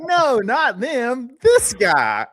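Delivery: high, slow, drawn-out mock voice; mock insistent correction. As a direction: stretch it out like a silly insistence. Imitating pointing out the reporter.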